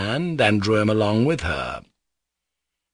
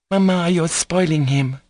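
put-on higher little girl's voice; little crying-out voice; 9 semitones higher than usual. Lisa calls to Mamma through the door, little raised voice. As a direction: call out in a small, high voice. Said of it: "Lisa's little voice cried from behind the door".

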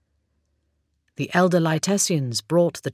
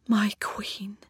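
inner thought whisper; 4 semitones higher, 5 dB quieter than usual. A narrator's reading. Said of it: "he thought".

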